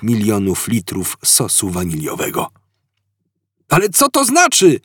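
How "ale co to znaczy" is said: much higher, calling out in confusion. Acted. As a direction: call across to your friend. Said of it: "zawołał Artur".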